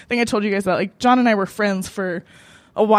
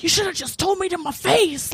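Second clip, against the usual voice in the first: higher, aggrieved mock complaint; retold anger; 5 semitones higher than usual. Drunk woman telling off the man who ghosted her, angry. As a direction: throw out a drunken accusation in retelling. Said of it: "I was just angry".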